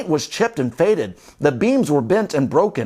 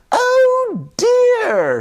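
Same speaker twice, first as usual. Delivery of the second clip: put-on very high old-lady oh dear; sisters; 3 dB louder than usual. Sisters fretting about the leak, very high. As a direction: fret in a piping high voice. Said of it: "said the sisters".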